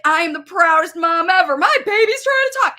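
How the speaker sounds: mock proud mom. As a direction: brag about the baby. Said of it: "This is a mom love moment".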